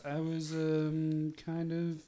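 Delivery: slow, halting reluctant admission; hesitant, drawn-out confession. Acted. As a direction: drag it out as if unwilling to admit it. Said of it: "so Victor says".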